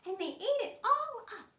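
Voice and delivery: very high little squeal of a voice; tiny bear. Acted. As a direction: pipe up like a wee upset bear cub. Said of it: "And the tiny bear says".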